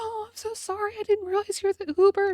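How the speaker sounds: high-pitched imitation of a little old lady; apologetic old lady. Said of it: "this little old lady".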